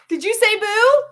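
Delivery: ordinary delivery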